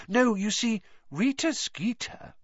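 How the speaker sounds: a touch of trembling triumph, high-pitched; quiet triumph. A narrator's reading. Hermione revealing Rita Skeeter's secret, with quiet triumph. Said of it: "voice trembled with quiet triumph".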